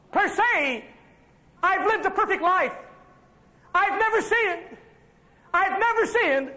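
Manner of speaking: high, earnest pulpit plea, slowing; full-force preaching. Acted. Preacher voicing Jesus arguing with the Father, full-force preaching. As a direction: plead Jesus' case to the Father.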